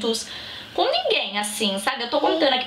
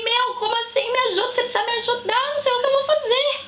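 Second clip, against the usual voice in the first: desperate plea; 13 semitones higher, faster than usual. Retold or imitated. Begging friend for help, panicked.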